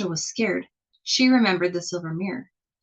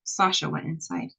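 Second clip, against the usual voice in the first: ordinary delivery, nothing marked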